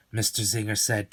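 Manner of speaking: plain reading voice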